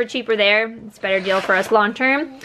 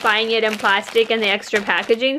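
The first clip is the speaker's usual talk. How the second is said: ordinary talk, nothing marked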